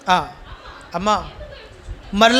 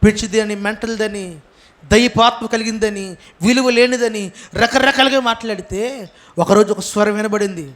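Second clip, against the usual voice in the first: ordinary talk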